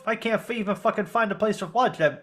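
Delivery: hammed-up Italian-American accent. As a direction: gripe like an Italian-American tough guy. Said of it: "Italian-American".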